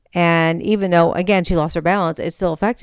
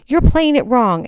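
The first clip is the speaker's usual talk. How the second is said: mock yelled telling-off, higher pitch; retold yelling; 6 semitones higher, 4 dB louder than usual. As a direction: imitate someone yelling a correction. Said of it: "he was yelling at me".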